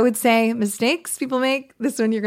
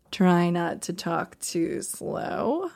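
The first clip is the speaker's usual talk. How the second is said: dragged out, very slow and softer; exaggeratedly slow, trailing delivery; very slow demo; 3 semitones lower, 5 dB quieter, much slower than usual. Shown as a demonstration. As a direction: stretch every word way out. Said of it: "I would say".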